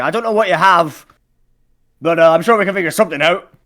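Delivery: high, loud merchant calling over; hearty holler, pitched well up; shouted negotiation. Acted. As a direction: project every phrase across the water. Merchant answers shouting from other boat.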